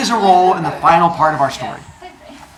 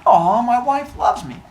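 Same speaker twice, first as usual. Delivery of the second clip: slow, a touch higher mock aww; 7 semitones higher than usual. Heracles receiving the cloak from his wife, mock touched. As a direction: sigh it, pleased and fond. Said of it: "He's like".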